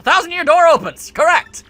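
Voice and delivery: loud, high triumphant answer; excited. Acted. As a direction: shout it out in triumph. Triumphant right answer in quiz.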